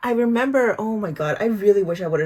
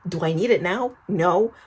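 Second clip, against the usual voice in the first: usual speaking voice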